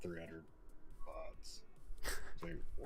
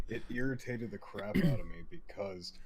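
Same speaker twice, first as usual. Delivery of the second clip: ordinary talk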